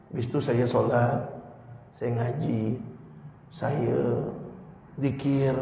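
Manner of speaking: soft, low-key voice as a smug believer telling his spouse; preacher mimics a man telling his wife he never cries; pretend talk to spouse. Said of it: "Misal nih".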